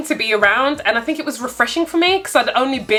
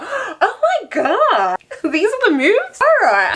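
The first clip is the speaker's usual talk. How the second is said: high, giggling oh my God; giggly mock shock; laughing disbelief; 9 semitones higher, slower than usual. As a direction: laugh through it, amazed. Laughing at his serenade moves.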